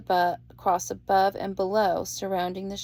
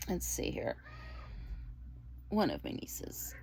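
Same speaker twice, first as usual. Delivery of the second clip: whispered aside; 8 dB quieter than usual. Real, not acted. Muttering aside, unvoiced.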